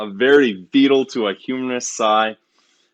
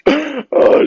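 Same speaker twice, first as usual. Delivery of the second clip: exaggerated gasp; 14 semitones higher, 4 dB louder than usual. Berowne mocking the princess's order.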